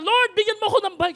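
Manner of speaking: put-on high, loud kid's yell; child shouting. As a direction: yell it up to heaven like an eager child. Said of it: "Sumigaw ulit yung bata". (batang sumisigaw nang malakas)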